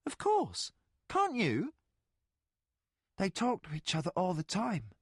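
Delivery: put-on a boy amazed anyone can't; boy William. William answering Colgan in a high boyish voice.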